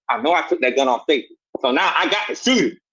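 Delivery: ordinary talk, nothing marked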